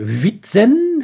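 "Fietsen" high, loud and stretched, oddly pronounced; baffled soldier sounding out "Fietsen"; uncomprehending echo. A narrator's reading. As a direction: repeat "Fietsen" like an unknown foreign word. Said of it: "of ze IJslands sprak".

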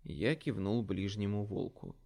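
plain reading voice

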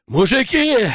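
high, urgent call; shouting. A narrator's reading. Messenger bursts into the hangar to the men, shouting. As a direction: call out to alert everyone. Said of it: "кричал он".

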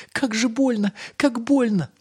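very high, loud wail; crying out in pain. A narrator's reading. Little Ryum wakes from nightmare hurting, crying out. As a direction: wail in a tiny child's voice. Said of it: "закричал маленький Рюм и проснулся".

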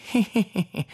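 high, sly chuckle; Orlik's sly chuckle. Acted. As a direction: chuckle knowingly. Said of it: "ridacchiò Orlik".

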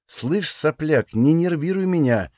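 drawn-out irritated threat; seething through teeth. A narrator's reading. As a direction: force it slowly through gritted teeth. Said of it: "процедила Сантану".

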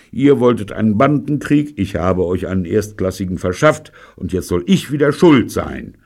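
loud, aggrieved protest; indignant. A narrator's reading. Man defending himself against his friends' blame, indignant. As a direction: complain loudly at being blamed. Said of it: "stöhnte Robert ungehalten".

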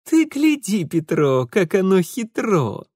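high-pitched gleeful marvelling; amused ruffian. Acted. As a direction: show off the find to your accomplice. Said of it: "и тихо засмеялся". (довольное изумление)

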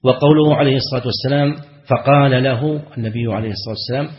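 ordinary talk, nothing marked

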